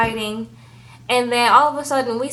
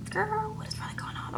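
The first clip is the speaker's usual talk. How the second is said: much higher and hushed, incredulous; high soft disbelief; 11 semitones higher, 13 dB quieter than usual. Retold or imitated. Incredulous quoted aside.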